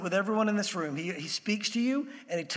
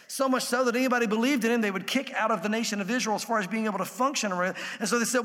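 usual speaking voice

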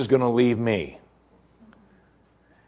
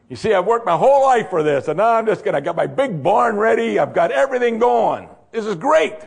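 much higher and louder, building gleeful boast; gloating rich man; 9 semitones higher, 4 dB louder than usual. Retold or imitated. Preacher acting the rich man gloating to himself, excited. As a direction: crow over the big barn.

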